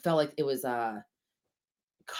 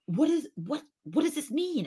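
high, puzzled asking; confused self; 10 semitones higher than usual. Retold or imitated. Lightly voiced confused self asking her husband. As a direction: play your own baffled younger self. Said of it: "And I'm like".

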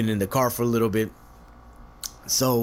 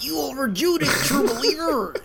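much higher, put-on old showman voice; Stan Lee impression. Stan Lee replying to the wrong name, high showman voice. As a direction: deliver it like a comic-book showman. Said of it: "He'd have been like".

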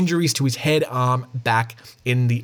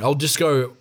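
ordinary talk

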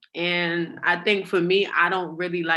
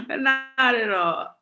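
laughing through the words; 9 semitones higher, 5 dB louder, much slower than usual. Real, not acted. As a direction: say it while laughing, high and slow. Laughing repeat of denial.

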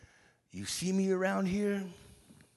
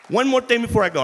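preaching voice; 8 semitones higher, 13 dB louder, faster than usual. Real, not acted. Preaching to the congregation.

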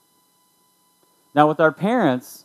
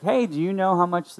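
higher-pitched mock-casual daughter; fake-casual; 7 semitones higher than usual. Daughter angling for season passes from dad, mock casual. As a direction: toss it out as an innocent, offhand question. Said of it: "tried to be super casual".

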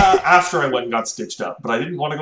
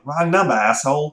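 mock Boston accent, rude; gruff Boston caller; lightly done Boston accent; 4 semitones higher than usual. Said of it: "really strong Boston accents".

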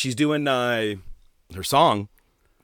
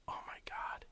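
barely voiced whisper to himself; hushed disbelief; 23 dB quieter than usual. Audience member recognizing the song's opening, hushed disbelief. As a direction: whisper it under the breath. Retelling his dread at hearing organ.

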